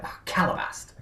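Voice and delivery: high, breathy exclamation of CALABAST; mock cursing. Cartoon character swears when something goes wrong, mock exasperation. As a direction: curse "Calabast" in a high, hushed voice.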